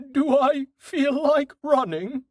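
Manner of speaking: very high, slow horse voice; high-pitched animal character voice; musing horse. A narrator's reading. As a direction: ponder as a horse, high and drawn out. Horse Dust thinking to himself.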